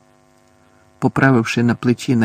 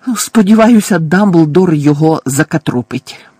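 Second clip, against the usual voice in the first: furious snarl; 3 semitones higher, 6 dB louder than usual. A narrator's reading. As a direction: snarl it after him. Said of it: "розлючено гаркнула місіс Фіг".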